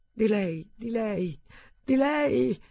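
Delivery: building to a high, loud scream. Acted. Marcus in tears screaming at Lucas, building high scream. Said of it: "urlò".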